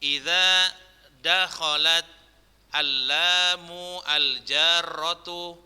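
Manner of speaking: slow, measured Arabic read in phrases; drawn-out syllables with long pauses between phrases; dictation reading. Shown as a demonstration. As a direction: read aloud slowly for students to mark vowels. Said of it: "Ustaz bacakan aja".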